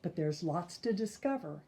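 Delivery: put-on lively, sing-song read-aloud for kids; storyteller voice for little listeners; reading to children. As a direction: read the rhymes like a bedtime picture book. Reads picture book to children.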